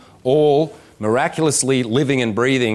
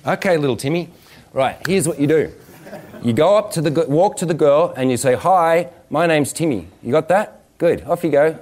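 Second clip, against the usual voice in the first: put-on higher, encouraging adult-to-child voice; teacher to small child; 4 semitones higher than usual. Talking a kindergartner through approaching a girl, teacher to small child. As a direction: coach a little kid through easy steps. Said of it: "teach it to a kindergarten child".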